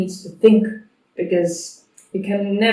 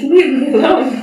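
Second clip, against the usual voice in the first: higher, louder, laughing mock protest; voice of disturbed dog; 7 semitones higher, 6 dB louder than usual. Trainer imitating a dog that wants solitude, mock protest. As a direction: playfully voice a pestered dog through laughter.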